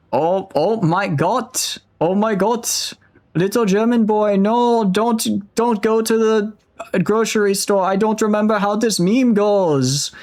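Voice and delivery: put-on high-pitched, loose German accent. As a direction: put on a squeaky German accent, slow on the exclamations. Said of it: "Can I do a German accent?".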